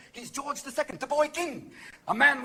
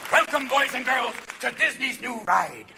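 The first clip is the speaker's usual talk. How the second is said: ride announcer. Acted. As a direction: present it like a theme-park announcement. Mock theme-park announcer.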